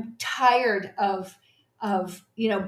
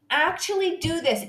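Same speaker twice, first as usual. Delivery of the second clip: ordinary talk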